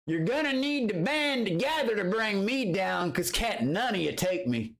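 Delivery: a swaggering taunt thrown at the whole group. Real, not acted. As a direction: dare them all to come at him. Taunting rivals on stream.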